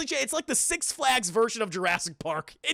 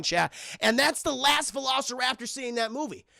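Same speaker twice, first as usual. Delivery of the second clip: normal talking voice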